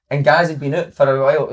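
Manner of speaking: normal talking voice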